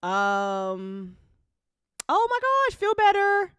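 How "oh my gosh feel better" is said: much higher, loud, cooing get well; high sympathy. Real, not acted. Host cooing get-well wishes to Raziel, very high.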